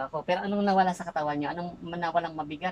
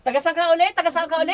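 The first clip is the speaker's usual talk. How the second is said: raised, very loud questioning; real forceful grilling; shouted interrogation; 8 semitones higher, 10 dB louder than usual. Real, not acted. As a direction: demand an answer, insistently. Healer interrogating the possessing spirit.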